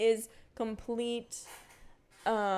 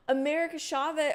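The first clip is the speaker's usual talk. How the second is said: surprised gasp; 8 semitones higher, 3 dB louder than usual. Real, not acted. Reads chat, sudden gasp.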